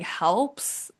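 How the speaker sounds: ordinary talk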